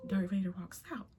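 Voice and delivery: whispered aside; soft, breathy-secret announcement; hushed aside. Retold or imitated. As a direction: drop to a whisper to announce an entrance.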